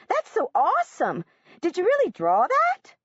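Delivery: high, eager schoolgirl voice; excited little girl asking; eager young girl. A narrator's reading. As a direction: gush like an impressed classmate. Emma admires the drawing.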